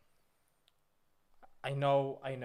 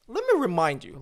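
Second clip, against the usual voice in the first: sharp raised voice, hitting me; loud; 9 dB louder than usual. Acted. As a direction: bark the warning loudly. Streamer angrily correcting chat.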